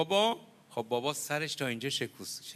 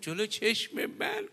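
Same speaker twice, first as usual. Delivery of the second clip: stricken disbelief; 7 semitones higher, slower than usual. Retold or imitated. Daughter's shocked question repeated.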